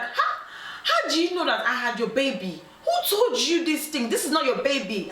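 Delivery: high, dramatic mock disbelief; hammed-up indignant denial; shocked denial. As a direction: act out a woman caught out, protesting. Woman denying the ex's claim.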